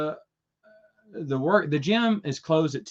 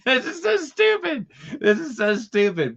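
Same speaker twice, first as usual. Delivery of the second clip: high, laughing self-mockery; squeaky amused groan; 11 semitones higher than usual. Real, not acted. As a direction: giggle through the words. Laughing at own story.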